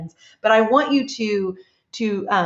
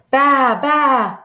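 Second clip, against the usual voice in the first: louder mock sheep bleat; 3 semitones higher, 5 dB louder than usual. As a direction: baa it out like a sheep in the mud.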